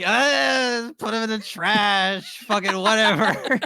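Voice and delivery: high, slow, loud careless shout. Retold or imitated. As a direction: yell it like you don't care. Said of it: "so that's why I was like".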